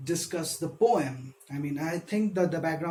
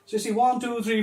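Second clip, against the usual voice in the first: normal talking voice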